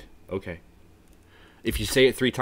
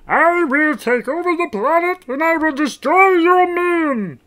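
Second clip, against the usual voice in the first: very high, loud, slow villain threat; strained cartoon conqueror at full volume; Marvin the Martian; 12 dB louder than usual. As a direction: shout a drawn-out alien proclamation. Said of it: "Marvin the Martian".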